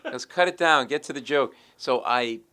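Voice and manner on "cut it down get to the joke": loud, higher impatient order, imitated; retold demand. Joke buyer orders a wordy writer, loud demand.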